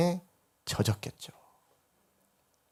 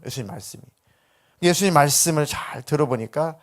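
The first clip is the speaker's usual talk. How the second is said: ordinary talk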